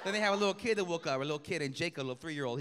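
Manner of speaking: ordinary talk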